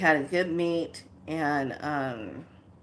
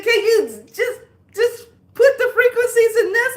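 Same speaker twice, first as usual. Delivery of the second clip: high, laughing mimic of the client asking a favour; imitating client; 8 dB louder than usual. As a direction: squeak it out like the amused client. Client's request voiced, laughing.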